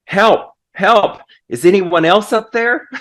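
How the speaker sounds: high, comic cry for help; mock yell for help. Man on a branch seeks anyone else, mock yelling. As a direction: call past God for a second opinion, half-yelled. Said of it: "he finally yelled out".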